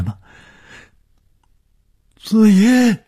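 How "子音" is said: very high, loud, drawn-out cry; despairing scream. A narrator's reading. Finding her shoes by the lake, despairing scream. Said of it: "一声绝望的嘶叫".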